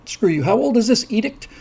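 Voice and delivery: indignant knight, played lightly; defiant mock scoffing. Acts knights mocking the sign.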